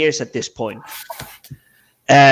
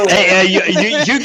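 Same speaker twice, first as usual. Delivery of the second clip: a teasing laugh breaks into the words; 5 semitones higher, 4 dB louder than usual. Real, not acted. Teasing the host over his hat on screen, laughing. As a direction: chuckle it out, louder, as you spot the hat.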